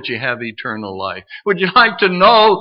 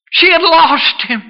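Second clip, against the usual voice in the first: high, impassioned cry on the whole line; high-pitched, emotional preacher's outcry; anguished emphasis; 14 semitones higher, 4 dB louder than usual. Real, not acted. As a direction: exclaim the loss at the top of the voice's pitch. Same line, cried then softened.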